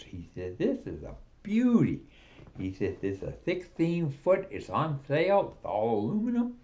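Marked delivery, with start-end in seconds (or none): 0.6-2.0 much higher, admiring the boat; gushing salesman. Acted. 3.0-6.6 eager salesman pitch. Acted.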